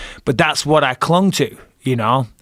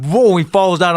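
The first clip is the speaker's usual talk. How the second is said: usual speaking voice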